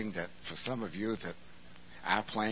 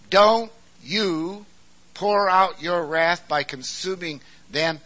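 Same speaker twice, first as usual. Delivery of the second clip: higher, louder urgent plea to God; Moses' pleading cry; 8 semitones higher, 10 dB louder, slower than usual. Acted. Moses begging God to spare Israel, loud and urgent. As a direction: push back on God with bold urgency. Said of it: "He said".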